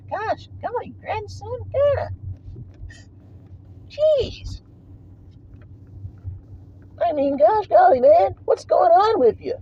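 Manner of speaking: high, thin mock voice of a complainer; pinched, high mock plea; mocking whiny critic. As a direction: ridicule the critic by whining it up high.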